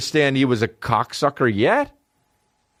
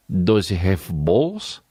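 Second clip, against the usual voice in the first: low, slow Russian question; mock Russian accent; 5 semitones lower, much slower than usual. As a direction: ask slowly as a baffled Russian. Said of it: "the Russian guy's like".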